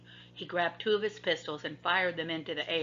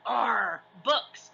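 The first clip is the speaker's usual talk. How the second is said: growl; 5 semitones higher than usual. A narrator's reading. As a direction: grumble it like a pirate. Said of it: "growled Captain Bones".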